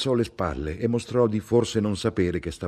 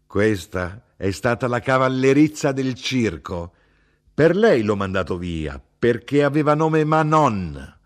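higher, louder mother, heated and scornful; a heated old Sicilian mother correcting her son; mother's voice, indignant; 5 semitones higher, 5 dB louder than usual. Acted. Mother to son about grandfather, higher and louder indignant voice.

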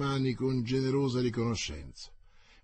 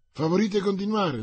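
eager; 9 semitones higher, 6 dB louder than usual. Acted. La regina esorta i gentiluomini.

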